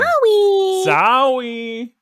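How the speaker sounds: high, small teasing sorry; squeaky mock sorry. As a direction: squeak it out like a chastened kid. Mocking apology, high squeak.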